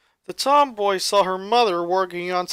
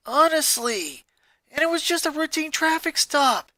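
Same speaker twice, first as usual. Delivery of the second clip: high, exasperated rant at the TV; 8 semitones higher than usual. Acted. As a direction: vent at the dumb driver on screen.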